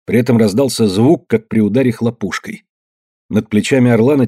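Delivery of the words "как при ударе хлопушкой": ordinary delivery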